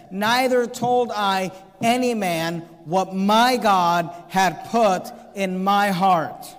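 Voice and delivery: drawn out, each word weighted; slow right down and press every word; solemn, deliberate scripture; very slow emphasis. Shown as a demonstration. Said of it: "notice what he says".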